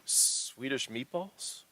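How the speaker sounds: slow, puzzled query with rising end; baffled, half-speed echo question; incredulous question. Acted. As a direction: draw it out in disbelief. Bartender puzzled by offered payment.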